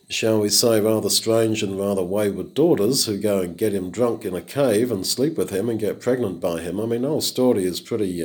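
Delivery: usual speaking voice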